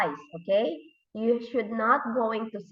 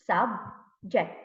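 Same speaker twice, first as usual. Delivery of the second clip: "subject" split as sub, ject with a gap; syllable by syllable; 2 semitones lower than usual. Shown as a demonstration. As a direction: break "subject" into two slow beats. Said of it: "how many syllables".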